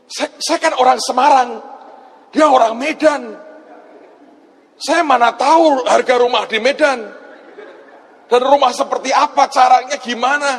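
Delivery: high-pitched comic bewilderment; flustered protest. Acted. Acts his flustered answer to audience. (protes bingung dengan suara tinggi)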